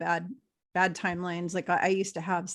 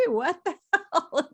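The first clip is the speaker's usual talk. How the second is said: laughing; 8 semitones higher, 3 dB louder than usual. Retold or imitated. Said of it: "And I'm like".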